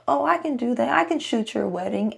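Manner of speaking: high, breezy mock boast; mock wannabe. Vlogger mocking an amateur who claims wedding skills. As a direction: send up a cocky amateur.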